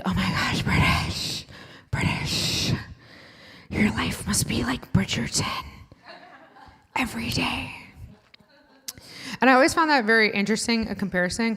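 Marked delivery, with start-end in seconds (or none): 0.0-7.7 breathless whisper of awe; mock starstruck whisper; gushing whisper. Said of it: "they'd be like".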